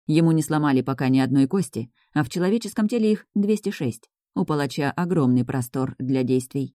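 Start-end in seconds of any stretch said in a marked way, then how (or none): none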